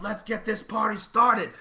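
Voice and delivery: high, loud, drawn-out holler; party-starting yell. Retold or imitated. Comic bursting out to open the show, yelling. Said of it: "comes out yelling".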